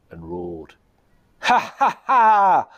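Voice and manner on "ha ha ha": high, loud mocking ha ha ha; goblin guffaw. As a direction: cackle with a full mouth. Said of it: "laughed the Goblin King".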